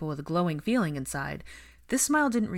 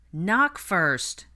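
raised voice, a contained shout; shouting after someone; 3 semitones higher, 7 dB louder than usual. A narrator's reading. Ginny calls after Fred as he heads upstairs, shouting lightly. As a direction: call after him, louder but not full-out. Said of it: "Ginny shouted after him".

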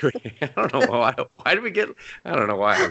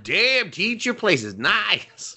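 drawn-out, higher mock voice of an impressed dude; mock impressed guy; 11 semitones higher, much slower than usual. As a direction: ham up a slow, awed compliment. Imitating friend visiting teacher.

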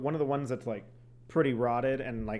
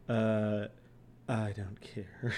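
bored; 4 semitones lower, 3 dB quieter, much slower than usual. Retold or imitated. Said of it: "they were probably still like".

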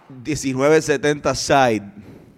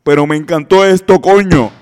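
higher, louder, slow announcer read; 4 semitones higher, 7 dB louder than usual. Shown as a demonstration. Reading another comment in a higher, louder announcer voice. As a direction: boom it out like a radio host. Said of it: "ponte una voz de locutor".

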